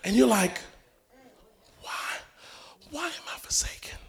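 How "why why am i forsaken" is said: breathy, much softer whispered cry; hammed-up forsaken whisper; mock despairing whisper.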